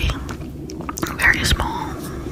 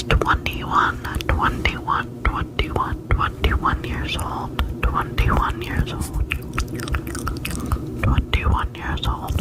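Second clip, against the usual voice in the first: tingly asmr mouth sounds; 9 semitones higher, much slower than usual. Acted. Slow repeated trigger words.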